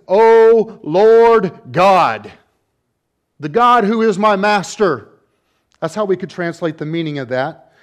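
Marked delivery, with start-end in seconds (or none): 0.1-5.1 loud, slow, drawn-out invocation; humbled Samson's prayer. Acted. Samson crying out to God, loud slow invocation. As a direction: call on God with awe, stretching each word. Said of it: "when the writer tells us what Samson says".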